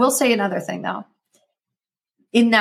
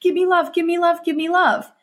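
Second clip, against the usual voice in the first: much higher, whiny begging; needy codependent plea; 10 semitones higher than usual. Retold or imitated. Codependent partner begging the other, whiny high plea. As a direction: beg for love like a clingy partner. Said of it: "codependent and like".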